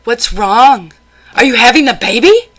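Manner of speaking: high, loud, fast mock-panicked questioning; older relative fussing over a pregnant woman, mock alarm; mimicking the relative. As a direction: fire it off like a jittery busybody. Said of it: "he'd be".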